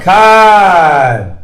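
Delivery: "Con" held long and falling; drawn-out CON. Shown as a demonstration. Preacher repeating the con for listeners, long drawn-out "Con". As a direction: stretch "Con" out in one long breath. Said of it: "It's the con".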